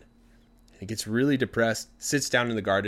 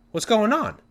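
high, louder questioning call; calling over fence; 12 semitones higher, 6 dB louder than usual. Acted. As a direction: pipe up, puzzled, over the fence. Husband calls over the fence.

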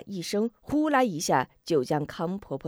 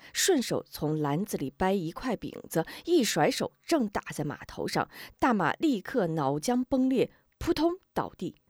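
usual speaking voice

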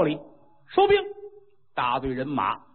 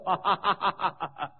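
a long triumphant ha-ha-ha laugh; hearty laugh; 2 semitones higher, 5 dB quieter than usual. Acted. Storyteller laughing over the enemy queen's fall, triumphant laugh. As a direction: laugh out in glee at the victory.

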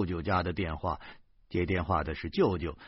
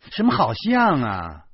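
louder, displeased retort; 4 semitones higher, 8 dB louder than usual. A narrator's reading. Wife annoyed at husband's vague answer, displeased louder retort. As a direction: snap back, not satisfied. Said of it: "老婆很不满".

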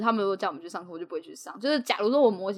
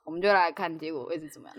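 normal talking voice

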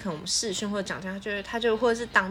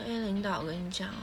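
limp lazy voice; 9 dB quieter than usual. Retold or imitated. Mocking her boyfriend's lazy way of talking, soft and limp. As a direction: let the words sag lazily. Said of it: "很没有力气讲话".

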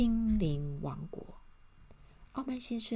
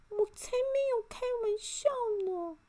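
protesting elf. A narrator's reading. Little elf denies joking to Mr. Arrogant, high protest. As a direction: squeal it back in a tiny elf voice. Elf answers back to the man.

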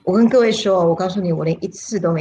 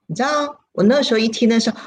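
normal talking voice

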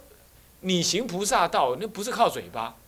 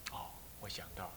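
soft breathy whisper of an inner thought; whispered realization; 11 semitones lower, 22 dB quieter than usual. Retold or imitated. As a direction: whisper it as if it just dawned on you. (恍然大悟般的轻声耳语)

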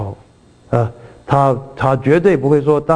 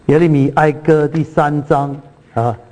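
ordinary talk

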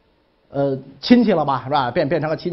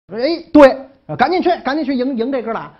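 eager agreement; 7 semitones higher, 6 dB louder than usual. Retold or imitated. Ministers eagerly agree to fetch princes.

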